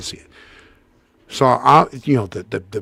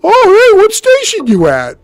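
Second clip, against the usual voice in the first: high, loud mock hail from some guy; chatty stranger; 9 dB louder than usual. Stranger in the beer line asks the fake fireman, hailing loudly. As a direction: call it out high-pitched, imitating a nosy stranger. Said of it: "some guy's like".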